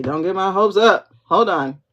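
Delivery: usual speaking voice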